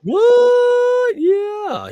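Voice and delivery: very high, loud, mocking squeal of surprise; mock shocked echo. Host echoing his guest's shocked what, as a hammed-up high squeal.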